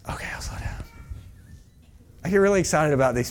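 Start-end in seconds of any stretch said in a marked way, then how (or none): 0.1-0.8 hushed whisper, barely there; whispered aside. Real, not acted. Preacher conceding to Chas that he talks too fast, whispered aside.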